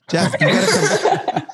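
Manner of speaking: high, drawn-out call; slow, high-pitched summons. Real, not acted. Calling Jeff over to join them.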